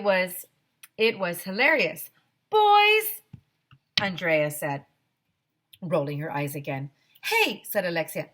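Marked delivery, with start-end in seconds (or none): 2.5-3.2 put-on high, loud scoffing "Boys"; scornful Andrea. 7.2-7.6 eager interjection. Acted. Alexia jumps in.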